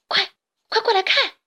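high, loud urgent call. A narrator's reading. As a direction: shout to friends down the tunnel. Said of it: "大嘴巴对伙伴们喊道". (大声呼喊伙伴)

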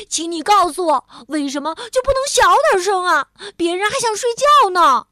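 high, loud exasperated complaint; sudden shout. A narrator's reading. Bengbeng wants to sleep, shouting crossly. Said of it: "突然大声喊道".

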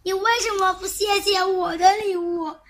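委屈地大声喊; shouting. A narrator's reading. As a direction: shout it out, hurt at being overlooked. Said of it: "奇巧先生大叫起来".

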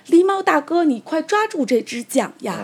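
high, loud storybook shout; urgent calling out. Acted. Rabbit urging the drowning raccoon dog to grab the oar, urgent high shout. 兔子假意喊狸猫抓桨.

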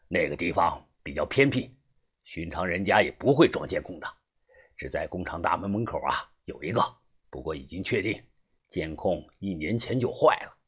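low-voiced man reporting to a boss; middle-aged fixer, assured. (中年男人低声笃定地汇报)

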